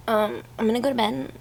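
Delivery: ordinary delivery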